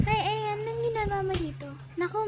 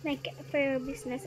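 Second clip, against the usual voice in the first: ordinary talk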